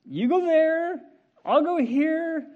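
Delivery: high, loud, slow dividing of turf; mock bargaining voice. Preacher voicing two people splitting territory, playful.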